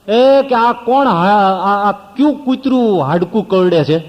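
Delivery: sharp, loud, high indignant call; high, loud indignant cry at the noise; angry shout. Retold or imitated. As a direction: demand who is making the noise, loudly. Angry sadhu shouting in the katha.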